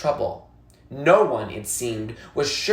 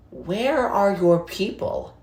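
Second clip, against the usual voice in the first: lightly voiced old woman; 6 semitones higher, slower than usual. Old woman asks boy.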